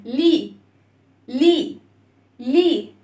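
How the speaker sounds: لي as "lee", the l sounded before the vowel; clear initial l. Shown as a demonstration. Teacher corrects children who drop the lam, clear "lee" لي. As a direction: say لي with its l, never a bare "ee". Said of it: "مش اي".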